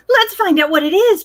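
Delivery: can't wait to explore; excitedly. Acted. Posy urging the ducks on, excited. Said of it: "Posy said excitedly".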